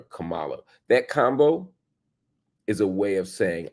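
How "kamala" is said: Kamala as kuh-MAH-luh; mispronounced name. Retold or imitated. Host cites the disrespectful version, Kamala mispronounced as kuh-MAH-luh. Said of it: "you're also calling her".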